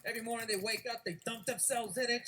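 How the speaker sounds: ordinary talk, nothing marked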